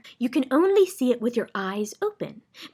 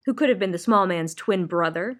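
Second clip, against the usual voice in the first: plain reading voice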